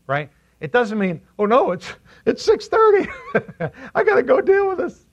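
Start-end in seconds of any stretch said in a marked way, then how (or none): 1.4-5.1 high panicky voice with a laugh; mock panic. Preacher mocks a rushed reconciliation before sundown. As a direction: play someone frantic that it's six thirty.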